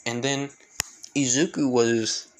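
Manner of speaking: usual speaking voice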